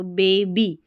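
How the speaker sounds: बेबी said slowly with a long ay in बे; long a in baby. Shown as a demonstration. Teacher models the long a in बेबी, slowly. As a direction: draw out बेबी with a long first vowel. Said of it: "ऐसा".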